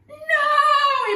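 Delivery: high loud cry of dismay; shout. A narrator's reading. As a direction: yell it out as the sun disappears. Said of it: "Abraham shouted".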